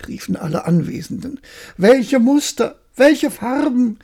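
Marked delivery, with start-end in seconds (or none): 1.8-4.0 high, slow and gushing; marvelling. Acted. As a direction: swoon over the colours. Courtiers marvelling.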